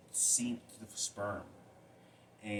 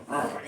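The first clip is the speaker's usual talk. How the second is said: imitated grunt; 6 semitones higher, 8 dB louder than usual.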